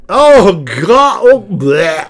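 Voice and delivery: loud, high groan of disgust. Real, not acted. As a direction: recoil in disgust. Disgust at the reference.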